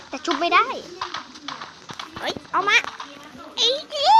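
rising alarm ending in a high yelled curse; frantic outburst. Real, not acted. Failed revive, frantic in fight.

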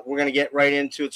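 normal talking voice

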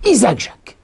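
father slyly proposing his own name back; mock bright idea. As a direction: sell the old name as a brand-new idea. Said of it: "mon père lui dit".

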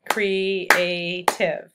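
"Creative" drawn out syllable by syllable for a class; clapped syllables. Shown as a demonstration. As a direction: clap out "Creative" beat by beat. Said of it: "let's clap the syllables and create".

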